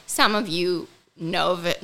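normal talking voice

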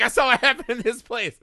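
high, loud brash put-down; a blunt mechanic lady telling the ranger off, loud and high; tough mechanic lady. Retold or imitated. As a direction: bark it at him like a fed-up local. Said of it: "She's like".

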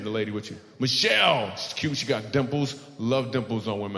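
ordinary talk

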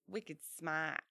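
mock Boston accent. Shown as a demonstration. Showing co-host how to do Boston, Boston accent. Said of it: "if I was doing Boston, I'd say".

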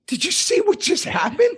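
high, stunned question; incredulous retold plea. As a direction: replay your own disbelief up high. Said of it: "I go".

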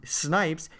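ordinary talk, nothing marked

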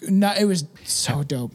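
ordinary delivery

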